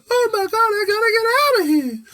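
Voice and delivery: very high, loud crying squeal; panicked kid. Retold or imitated. Andy seeing Chucky on the roof, wailing in panic. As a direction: wail it like a terrified child. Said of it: "he's like".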